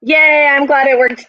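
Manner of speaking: excited cheer. Real, not acted. Cheering viewer success.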